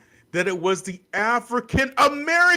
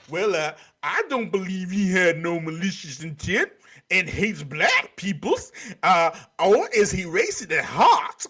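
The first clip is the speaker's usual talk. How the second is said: lower, dopey mock read; a hint of a mocking parody; 6 semitones lower than usual. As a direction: read it like a fool. Mocking quoted defender.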